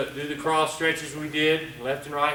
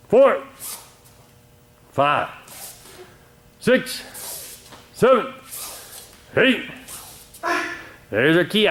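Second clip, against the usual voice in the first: louder commanding instructor voice; dojo instructor calling the count; 8 dB louder than usual. Acted. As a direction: drive the class through the kata, count by count. Leads kata for class and viewers.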